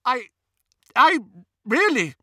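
high, halting, broken start; flustered stammer. A narrator's reading. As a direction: stumble over the first word, rattled. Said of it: "stammered Hermione".

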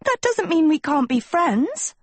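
high, called after someone walking away; calling out. Acted. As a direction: call out sweetly to the retreating friend. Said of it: "Sophie called".

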